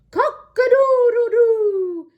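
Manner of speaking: high, loud crowing; rooster crow. Acted.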